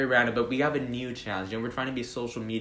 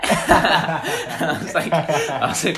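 laughing; 6 semitones higher, 10 dB louder, much slower than usual. Real, not acted. Laughing while retelling.

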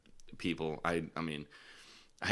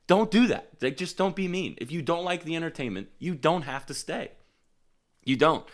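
high, loud scolding; raised, stern don't-do-that; scolding warning; 10 semitones higher, 4 dB louder than usual. Acted. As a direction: wag a finger at the heckler. Scolding hecklers, quoting comic.

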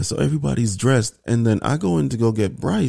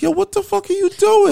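much higher, louder exasperated outburst; hammed-up disbelief at the wife; husband glaring at his wife, mock outraged and much higher; mock outrage; 4 dB louder than usual. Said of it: "I gave her daggers".

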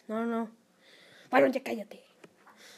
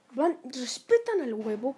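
ordinary delivery

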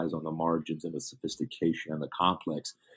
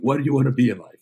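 higher and louder, demanding the answer; menacing shout; 5 semitones higher, 7 dB louder, faster than usual. Retold or imitated. Tyler Durden threatening the store clerk, mock menacing shout. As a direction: bark it like a gun is at his head.

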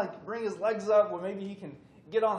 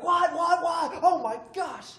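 alarmed mom; 12 semitones higher, 9 dB louder, slower than usual. Retold or imitated. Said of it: "Mom's like".

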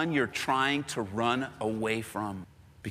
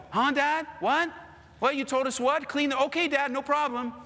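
higher, innocent kid voice acting confused; playing dumb kid; 9 semitones higher than usual. Kid playing dumb to his dad, higher innocent voice. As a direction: act the clueless kid who didn't hear.

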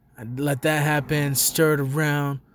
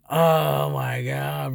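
drawn-out awe; much slower than usual. Real, not acted. Thrilled about the concert.

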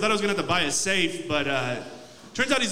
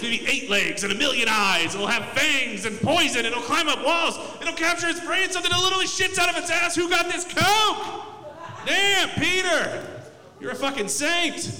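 God designing spiders; 4 semitones higher than usual. Retold or imitated. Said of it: "He's just like".

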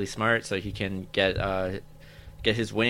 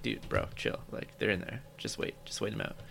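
calm chill-out quote; 13 dB quieter than usual. Retold or imitated. Metropolitan Man telling the worried guy to chill, softly. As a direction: play it cool and hushed, brushing off worry. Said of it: "And Metropolitan Man's like".

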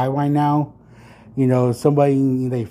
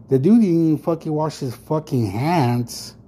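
ordinary talk, nothing marked

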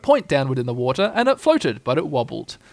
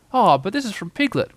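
higher-pitched exclamation of discovery; startled cry; 6 semitones higher than usual. A narrator's reading. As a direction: exclaim as a startled storybook boy. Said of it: "cried Christopher Robin when he had".

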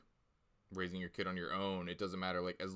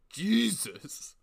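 shocked gasp; 11 semitones higher, 8 dB louder than usual. Retold or imitated. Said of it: "I'm like".

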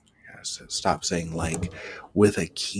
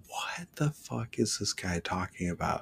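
hushed, whispery mock bewilderment; baffled aside; 7 dB quieter than usual. Player puzzled by the coach's buffalo talk, whispering. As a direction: say it under your breath, baffled.

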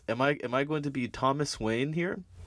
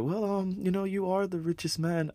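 much higher, prim wife voice; 9 semitones higher than usual. Retold or imitated. Wife reminding Thomas Wayne he is richest, in a high prim voice. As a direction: play the wife, pitched way up and fussy. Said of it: "And then his wife's over there".

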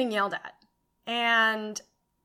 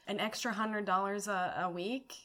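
quiet inner thought; 2 semitones lower, 9 dB quieter than usual. Retold or imitated. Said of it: "that's when I was like".

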